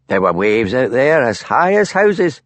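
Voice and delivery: slow weathered Highland fisherman voice; a fisherman telling of the storm, slowly; old fisherman Archie. A narrator's reading. Fisherman Archie tells Hamish of the storm, slow weathered voice.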